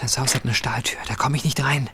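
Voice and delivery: urgent whisper. Acted. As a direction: whisper the plan tensely. Hiding outside the kidnapper's house.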